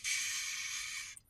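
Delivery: a breathy whispered shh; hushing whisper. Retold or imitated. German soldier hushing his dying victim, whispered. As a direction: hush him like he's falling asleep. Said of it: "and he's like".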